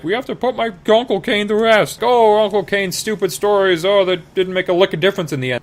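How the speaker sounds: high, mocking falsetto; a hammed-up high whining girl; whiny Leia. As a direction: lament in a squeaky girl voice. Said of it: "Leia's all like".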